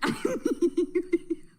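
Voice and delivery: breathy, unvoiced high giggle; whispered giggle. Real, not acted. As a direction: giggle under your breath. Whispered laugh.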